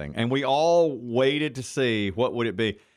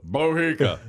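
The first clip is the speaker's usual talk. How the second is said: louder punchy repeat of his saying; tough radio veteran; 5 semitones higher, 4 dB louder than usual. Retold or imitated. Retelling the old boss's meeting catchphrase, a louder gruff echo. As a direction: bark it out like the gruff old boss. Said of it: "he'd go".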